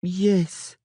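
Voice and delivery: put-on high girlish voice, subdued and sulky; a scolded child giving in; mumbling girl. Ciri giving in after the scolding, subdued mumble. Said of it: "she mumbled".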